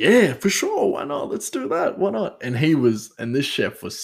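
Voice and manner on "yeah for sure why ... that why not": high, exaggerated mock enthusiasm; sarcastic agreement. Joking back to the chef's idea, sarcastic. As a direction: fake keenness, dripping irony. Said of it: "I said jokingly, sarcastically".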